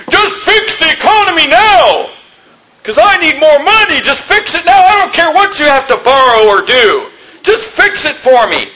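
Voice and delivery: high, whining mock of a greedy citizen; whiny selfish voter. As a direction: sneer out a spoiled demand for money. Mocking selfish voter demanding money.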